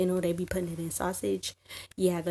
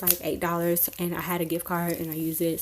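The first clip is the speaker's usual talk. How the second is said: normal talking voice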